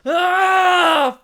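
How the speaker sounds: high, loud pained scream. Acted. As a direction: scream as the lightning hits. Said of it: "Phil screamed".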